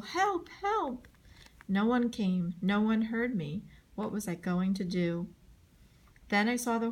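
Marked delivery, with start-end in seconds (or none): 0.0-1.1 high, desperate cry of "Help!"; yelling for help. A narrator's reading. Boy running to school yells for help, high and desperate. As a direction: cry out high for someone to come. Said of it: "I yelled".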